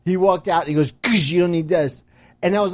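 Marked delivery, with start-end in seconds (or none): 0.9-2.0 loud, higher, offhand verdict; retold brash dismissal. Buddy kicking out the wood, brashly dismissive. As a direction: blurt it while booting something away. Same retold kick, told again.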